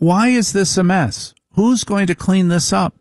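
nagging wife. Retold or imitated. Comic voicing his wife's demands in a high, complaining mock voice. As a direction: whine it like a fussy wife.

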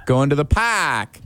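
drawn-out Boston accent, "park" as "pahk"; demonstrated Boston accent. Shown as a demonstration. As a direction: slowly show the Boston "ah" in "park". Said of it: "they replace it with A A H".

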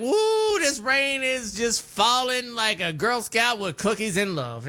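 high, playful gushing impression of a friend; hammed-up folksy gush, voice jumping up on Woo; imitating Wade. Cohost mimics Wade's made-up sayings, playful gushing. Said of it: "He's like".

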